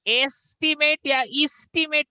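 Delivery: "एस्टिमेट" first as ace-timate, then as ees-timate; wrong pronunciations. Shown as a demonstration. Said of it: "नहीं होगा".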